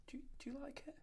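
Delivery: high airy whisper; that soft voice. Shown as a demonstration. Tattooist mimicking her friend's trick, high whisper. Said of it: "you say it in that voice".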